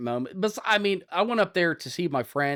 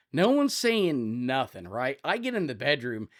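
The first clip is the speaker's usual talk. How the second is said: ordinary delivery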